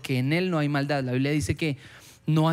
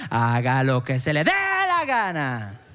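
slow, drawn-out fed-up dad, lingering on dé; Andrés López father; 3 semitones lower, slower than usual. Retold or imitated. Father finally giving in to his son, slow resigned comic delivery. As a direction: lay it on slow and resigned like a comedian's dad bit. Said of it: "Así como Andrés López".

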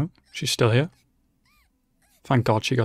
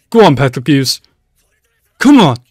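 root for him at the top of your voice; cheering shout; 3 semitones higher, 9 dB louder than usual. Real, not acted. Cheering on a character.

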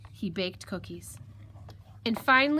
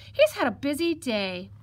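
higher piglet voice, mildly pitying; sighed sympathy; 5 semitones higher, 5 dB louder than usual. Acted. Piglet pities the worn-out fox, sighing lightly. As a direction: say it with an understated sigh. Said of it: "sighed the little piglet".

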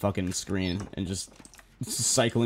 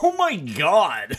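slow, high, loud oh my god; shocked shout; 6 semitones higher, 5 dB louder, much slower than usual. Real, not acted. Player startled by a jumpscare, slow loud shout. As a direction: stretch each word in alarm.

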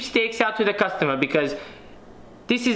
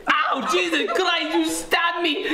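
high yelp of pain, slow start; 10 semitones higher, slower than usual. Acted. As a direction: cry out as if stabbed. Stabbed by fork.

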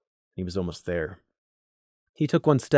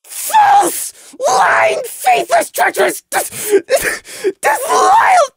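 screamed, crying, far higher and louder; inarticulate spitting rage; 9 dB louder, much slower than usual. Acted. Prey screaming at Lemon in tearful fury. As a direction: hurl each word like an accusation, sobbing. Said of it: "Prey spat, inarticulate".

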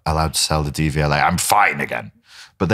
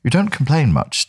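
posh doctor; 8 semitones higher than usual. Retold or imitated. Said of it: "she's quite posh".

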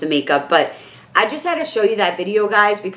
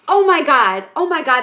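mock starstruck gush; 7 semitones higher than usual. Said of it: "That's all I kept saying".

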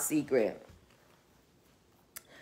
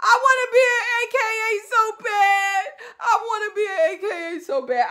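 laugh-crying plea; 13 semitones higher, faster than usual. Real, not acted. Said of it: "laughing and crying wish".

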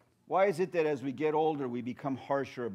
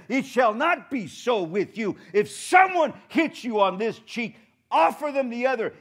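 preaching; 9 semitones higher, 10 dB louder than usual. Acted. Preacher shouts Jesus' command to the congregation, preaching. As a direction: proclaim it to the hall.

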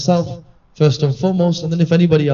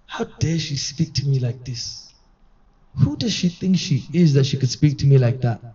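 retold indignation; 3 semitones lower, 5 dB quieter than usual. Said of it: "he said".

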